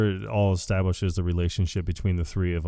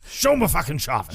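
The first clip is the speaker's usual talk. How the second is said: Walter impression; 5 semitones higher than usual. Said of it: "Walter line".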